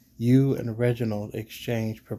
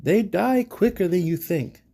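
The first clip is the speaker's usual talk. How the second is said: defensive retort, higher pitched; 8 semitones higher, 4 dB louder than usual. A narrator's reading. Player's character justifying a quick death to Siba, defensive. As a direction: blurt it out a little too defensively. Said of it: "perhaps a bit more defensively".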